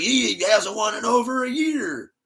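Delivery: high, loud mocking shout; mocking yell. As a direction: mimic a whiny accuser, yelling. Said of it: "he's like".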